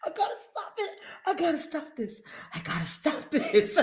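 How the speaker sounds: soft, spent laughing voice; real helpless laughter. Real, not acted. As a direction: plead weakly through laughter. Helpless laughter, trying to stop.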